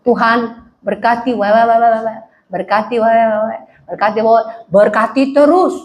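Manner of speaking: low, monotone parody of a prayer; low droning send-up of someone always asking; mock begging prayer. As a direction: babble the prayer as endless repeated requests. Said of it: "kalau berdoa minta dulu".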